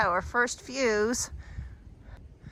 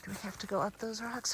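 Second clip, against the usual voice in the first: quiet; 10 dB quieter than usual. Real, not acted. Facing more rocks while climbing.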